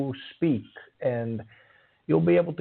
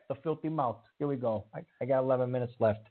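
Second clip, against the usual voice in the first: normal talking voice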